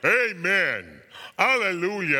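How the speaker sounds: loud, high "Amen"; ringing, exultant acclamation; crying out praise. A narrator's reading. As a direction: cry it out in worship. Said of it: "And they too cried".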